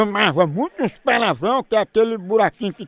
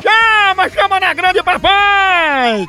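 agudo e forte, bordão de rádio; grito animado; 9 semitones higher, 4 dB louder, much slower than usual. Real, not acted. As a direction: puxa o bordão lá em cima. Host echoing a caller's catchphrase. (grito de bordão de locutor)

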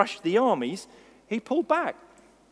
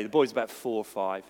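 normal talking voice

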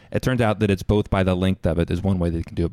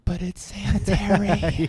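drawn-out sarcastic sanitary, raised and slowed; mocking sarcasm; 9 semitones higher than usual. Mocking a dog owner's excuse, sarcastic and slow. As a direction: scoff at the claim in a mock chirpy tone.

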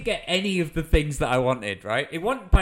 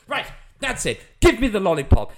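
high mock outburst, building louder; imitated stern demand; 6 semitones higher, 8 dB louder than usual. Landowner confiscating a child's lollipop, imitated angry order. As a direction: act the fed-up adult laying down the law. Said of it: "He goes".

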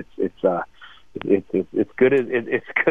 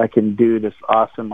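normal talking voice

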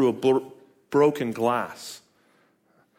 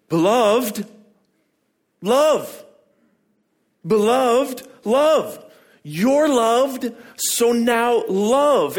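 much higher, louder, forceful; emphatic; 11 semitones higher, 6 dB louder, much slower than usual. Shown as a demonstration. Pastor showing the emphatic Greek to his church, forceful. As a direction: punch Beloved and love hard. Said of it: "It's emphatic. It's placed at the front. It's aggressive".

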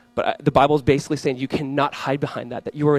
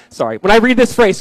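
ordinary delivery

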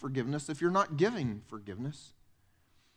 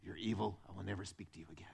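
soft, sulky, wounded mutter; offended huff; 9 semitones lower, 11 dB quieter, faster than usual. Retold or imitated. Churchgoer muttering offended at a friend. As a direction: sulk like you were wronged. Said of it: "we walk around and go".

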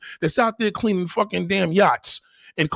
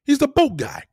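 ordinary talk

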